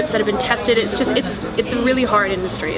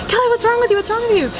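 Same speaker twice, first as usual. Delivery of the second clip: imitating an alarmed mom, pitched way up; worried mom; 13 semitones higher, faster than usual. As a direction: mom demanding an answer from her sobbing daughter. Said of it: "she's like".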